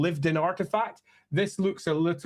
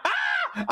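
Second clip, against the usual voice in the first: very high mock cry; short high-pitched "Ah!"; excited squeal. Acting out the villain grabbing his warpstone snack, excited squeal.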